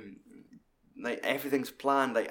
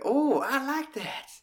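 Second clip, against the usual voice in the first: much higher, slow put-on delight; delighted Minnie; 13 semitones higher, slower than usual. Minnie hearing French, high slow mock delight. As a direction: coo it slowly in a high mock voice.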